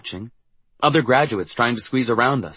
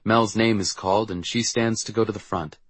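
ordinary delivery, nothing marked

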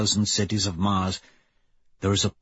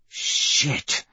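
murmured curse; 4 semitones higher than usual. A narrator's reading. Severo to the crew over comms after the flash, murmured. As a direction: murmur it under the breath, mostly voiceless. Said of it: "Severo murmurs".

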